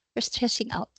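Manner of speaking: put-on hushed echo of the child; whisper. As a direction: breathe "You're stressing out" as a whisper. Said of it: "Sabi nga nung anak".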